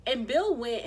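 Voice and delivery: ordinary talk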